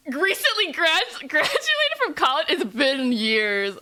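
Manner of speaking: high, loud, drawn-out wail; mock outrage. Streamer reacts to college line, hammed-up wail. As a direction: wail in exaggerated disbelief.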